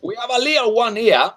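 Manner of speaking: high, loud barker's call; loud carnival barker. Acted. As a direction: bellow it like a carnival barker working the crowd. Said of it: "That's why I speak so loud".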